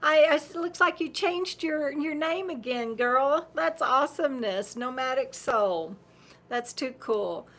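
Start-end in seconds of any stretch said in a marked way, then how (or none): none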